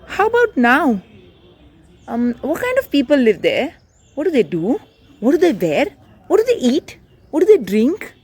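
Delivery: put-on a child pestering his parents; curious nagging kid. A narrator's reading. Putti pestering parents with questions, high and eager.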